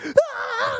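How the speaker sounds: high, loud startled yelp; mock startled scream. Retelling Gino scared out of the shower, high shocked yelp. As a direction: yelp like someone caught in the shower. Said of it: "was like".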